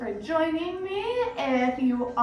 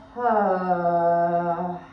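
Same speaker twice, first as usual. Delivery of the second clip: low, heavy sigh on an open vowel; open-mouth exhale; 6 semitones lower, 3 dB louder than usual. Shown as a demonstration. Yoga teacher letting weight fall into the mat, open-mouth exhale. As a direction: sigh out audibly, pitch dropped. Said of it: "Open mouth exhale".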